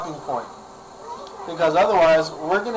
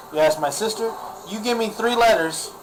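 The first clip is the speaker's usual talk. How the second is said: ordinary talk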